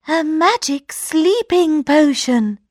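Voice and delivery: high excited princess; eager princess. A narrator's reading. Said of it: "said the princess".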